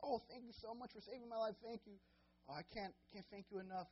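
rescued man thanking his rescuer, pitched high; mock grateful man. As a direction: play the rescued man overflowing with gratitude. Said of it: "He's like".

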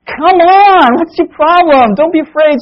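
high, loud irritated wake-up; groggy annoyed Jesus. Acted. Jesus woken from his nap, scolding the disciples, high and loud. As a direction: grumble at them like someone woken from a nap. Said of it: "I always imagine Jesus is like".

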